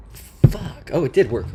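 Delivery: loud sudden surprise; surprised outburst. Real, not acted. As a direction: blurt it out, caught off guard. Code suddenly works.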